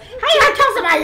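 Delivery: high, loud, fast teasing squeal; mocking squeal. Real, not acted. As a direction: squeal it back at her, mocking the threat. Mocking daughter's threat.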